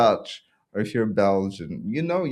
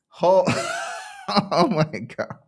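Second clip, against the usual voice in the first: stunned, drawn-out words through real laughter; laughing shock; 8 semitones higher, slower than usual. Real, not acted. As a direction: crack up in disbelief. Reacts to the donation figure.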